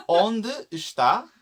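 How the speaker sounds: slow laughing "Onde está?" rising at the end; laughing model phrase. Shown as a demonstration. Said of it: "this is quite interesting".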